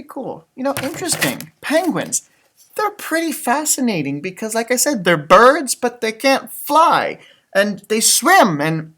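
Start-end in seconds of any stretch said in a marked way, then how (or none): none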